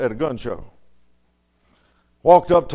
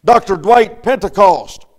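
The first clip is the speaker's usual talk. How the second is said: full-force preaching; 5 semitones higher, 6 dB louder than usual. Acted. Pastor rousing the congregation about heaven, full-force preaching. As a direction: build it up and hammer each phrase home.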